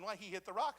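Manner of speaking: ordinary talk, nothing marked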